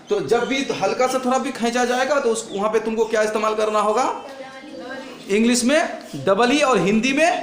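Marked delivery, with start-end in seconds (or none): none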